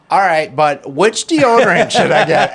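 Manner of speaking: imitating wife. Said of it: "she goes".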